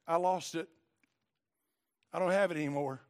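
low, subdued voice of someone beaten down; defeated. Retold or imitated. Voices a defeated, tearful convert.

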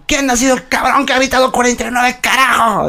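high-pitched sergeant demanding the culprit; angry sergeant. Retold or imitated. Sergeant demanding who shouted forty-nine, mock-angry high military bark. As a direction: imitate a drill sergeant calling out the offender. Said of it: "Así el sargento".

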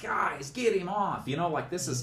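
high, drawn-out mock appeal; high, slow, put-upon pleading; exasperated plea. Acting out ordering his rescuers.

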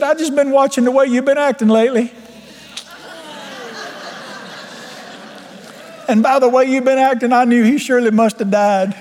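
high, sustained wife voice; wife's retort. Retold or imitated. Wife telling husband about his behaviour, high imitated voice.

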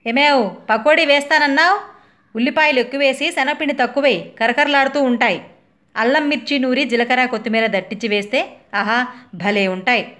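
high, loud call from another room; husband calling orders. Retold or imitated. Husband calling from the hall for pakodis, loud demanding. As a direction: shout the recipe demands across the house. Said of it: "order వినపడుతోంది హాల్లో నుండి".

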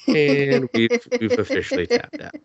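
high, put-on voice of someone tapping out; playful high mock exhaustion; mock bored listener. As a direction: squeak it like a bored friend giving up.

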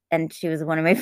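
normal talking voice

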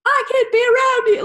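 high, loud melodramatic outburst; hammed-up high shout; bursting in. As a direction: imitate an overacting extra bursting into a scene. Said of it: "bursts in".

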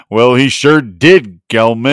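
a John Wayne style American impression; mock American accent. Host repeats a friend's line in a mock American accent. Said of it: "his American accent".

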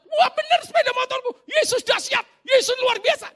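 very high, loud jubilant shout; acted-out yell of joy, far above normal pitch; excited shouting. Friend finding the stolen motorbike praises Jesus, shouting excitedly. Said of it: "Wah dia malah teriak-teriak".

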